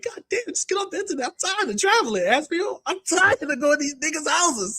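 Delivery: high, fast, hammed-up weary voice; family members mocked, high and exhausted. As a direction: whine like relatives sick of travelling. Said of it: "your family was like".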